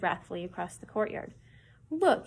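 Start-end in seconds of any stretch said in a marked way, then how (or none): 1.9-2.3 high, loud cry of look. A narrator's reading. Girl pointing across the courtyard, a loud cry. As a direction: call out sharply to make him look. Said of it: "he cried".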